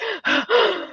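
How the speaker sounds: high, quick acted gasping; out-of-breath gasp. Fleeing mother struggles for air, breathless gasp. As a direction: mimic sucking in air after running. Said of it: "I'm, like, out of breath".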